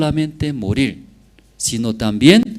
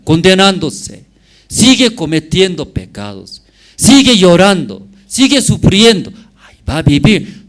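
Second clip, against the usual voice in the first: rising preacher's warning; 7 semitones higher, 7 dB louder than usual. Real, not acted. Preacher warns of sinful life.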